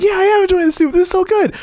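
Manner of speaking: high, forced cheery voice; boyfriend. Retold or imitated. Cohost voicing the boyfriend praising suspicious soup, forced cheer. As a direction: fake enthusiasm, squeaking it out. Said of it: "And he's like".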